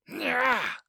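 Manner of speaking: high shrill charge yell; Becca's high war cry as she charges with a bat; charging battle cry. A narrator's reading. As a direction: scream it as you swing. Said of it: "Becca screams as she charges".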